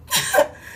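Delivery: a sudden high laugh; burst of laughter. Real, not acted. Laughs mid reading.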